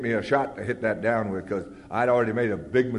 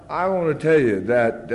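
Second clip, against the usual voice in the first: ordinary delivery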